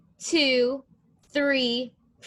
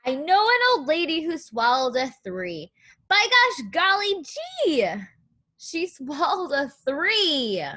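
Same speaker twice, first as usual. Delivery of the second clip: lilting, louder read-aloud rhyme; storybook reading; 5 semitones higher, 4 dB louder than usual. Acted. Teacher reading the rhyme to young children, sing-song. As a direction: read the rhyme like a picture book to kids.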